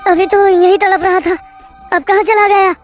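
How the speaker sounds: baffled. Acted. Narrator baffled, body vanished.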